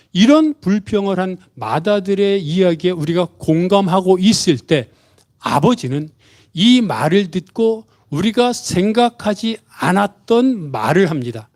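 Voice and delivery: ordinary talk, nothing marked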